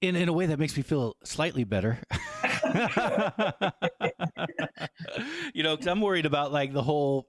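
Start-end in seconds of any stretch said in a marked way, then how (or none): 2.0-5.5 long, higher-pitched chuckling laugh; amused laugh. Real, not acted. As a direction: laugh at length, rising at the end. Amused reaction to own gloomy remark.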